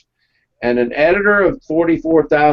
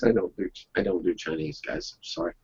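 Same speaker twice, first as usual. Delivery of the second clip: sheepish aside; 8 semitones lower, 11 dB quieter, faster than usual. Real, not acted. Embarrassed aside to the audience.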